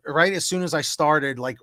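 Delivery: normal talking voice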